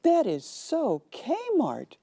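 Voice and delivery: scornful dismissal. Retold or imitated. Zola scoffs.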